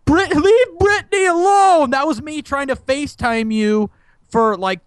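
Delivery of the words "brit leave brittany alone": high, tearful mock plea; hammed-up wailing protest; mock crying. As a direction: imitate a hysterical crying fan. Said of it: "like, crying".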